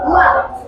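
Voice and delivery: "Ma" starting high, pressed sharply down; fourth tone. Shown as a demonstration. Teacher demonstrating tone four, falling-tone demo. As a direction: drop "Ma" short with no rise at the end.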